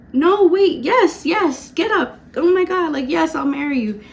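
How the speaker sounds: high, loud, panicked yes; panicked excited yes to her fiancé; frantic yes. Retold or imitated. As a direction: scramble to take it back, loud and high. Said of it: "I had to say, like, five times".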